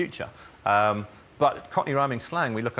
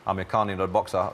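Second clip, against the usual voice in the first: usual speaking voice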